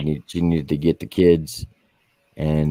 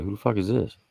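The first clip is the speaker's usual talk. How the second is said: higher, softer mock wife on the phone; retold suspicious question; 5 semitones higher, 5 dB quieter than usual. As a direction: quietly demand who is calling, as retold.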